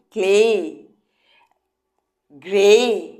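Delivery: ক্লে and গ্রে said loud and drawn out, with a long "ay" ending; a loud, clear pronunciation demo of ক্লে and গ্রে; teacher pronouncing words. Shown as a demonstration. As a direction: stretch out the "ay" at the end of ক্লে and গ্রে. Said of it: "তুমি উচ্চারণটা কী করছো".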